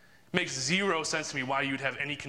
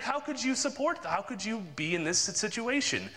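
sarcastic; 6 semitones higher than usual. Retold or imitated. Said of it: "I was kinda being sarcastic with the guy".

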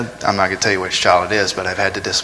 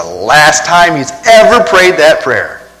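louder and slower, leaning on ever; emphatic loud preaching; 6 semitones higher, 8 dB louder, slower than usual. Real, not acted. Preacher to congregation about prayer, loud and slow. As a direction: drive home the last time he prayed it.

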